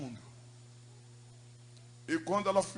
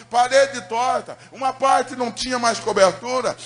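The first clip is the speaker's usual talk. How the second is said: fervent preaching; 5 semitones higher, 9 dB louder than usual. Acted. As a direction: preach the ruined house like a lament. Preaching the story at full force. (pregação inflamada, mais alta e forte)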